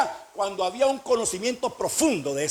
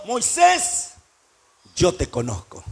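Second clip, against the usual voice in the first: voice of God; 4 semitones lower, slower than usual. Acted. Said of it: "¿Qué está diciendo?".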